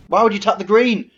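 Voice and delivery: high, loud indignant shout at the game; furious outburst. Real, not acted. Streamer raging at his auto tapper, furious high shout. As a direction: yell in disbelief at the misplay.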